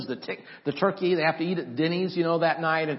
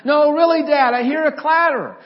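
high, loud pleading shout; acting out a kid insisting to his dad; insistent boy; 10 semitones higher, 9 dB louder than usual. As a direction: push it high and loud, desperate to be believed. Son voiced, insisting.

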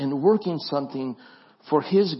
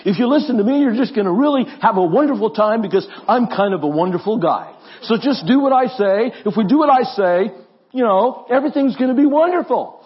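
loud, higher boastful politician parody; Donald Trump; 5 semitones higher, 5 dB louder than usual. Acted. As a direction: brag and promise like a self-satisfied salesman-politician. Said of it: "maybe it's the Donald Trump voice".

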